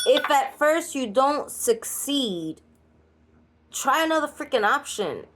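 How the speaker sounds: blunt New Yorican straight talk; New Yorican accent. Shown as a demonstration. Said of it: "Straight talk for straight understanding with my New Yorican accent".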